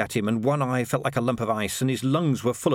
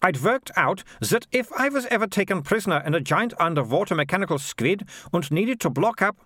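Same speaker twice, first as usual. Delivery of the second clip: put-on high-pitched, triumphant girl; Militia; 8 semitones higher than usual. Girl swinging her bag round, high and triumphant.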